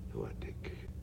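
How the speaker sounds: tender sad whisper. Acted. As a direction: whisper with fond pity. Whispered fondly.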